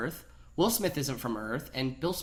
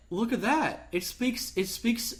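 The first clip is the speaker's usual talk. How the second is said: higher, mock-amazed gushing; hammed-up amazement, higher; 6 semitones higher, slower than usual. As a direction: gush like a dazzled scientist, higher.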